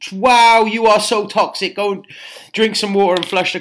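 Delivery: loud, high exaggerated wow; hammed-up horror at the news; mock shock. Mock shock at client's brown pee.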